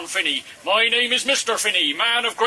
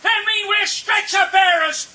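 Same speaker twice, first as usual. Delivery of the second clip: furious shout; 13 semitones higher than usual. Acted. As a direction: scream at them in outrage. Stretcher bearer yelling at attackers.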